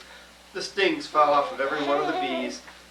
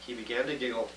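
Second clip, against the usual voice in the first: ordinary delivery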